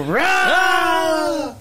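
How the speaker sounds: high, loud hype yell; hype shout. Pretend. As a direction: shout it like a cheer. Hyping into commercial break.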